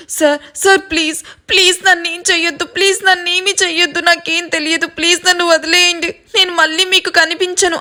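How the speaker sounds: high, desperate begging; crying plea. A narrator's reading. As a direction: sob out a frantic plea. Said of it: "అని ఏడుస్తూ ఉంటే".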